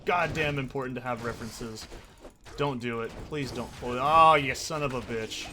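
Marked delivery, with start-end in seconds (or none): 3.8-5.5 loud burst on oh, then easing; loud, exasperated cursing; real outburst. Real, not acted. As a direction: curse at the game as it goes wrong. Pleading, then cursing at the game.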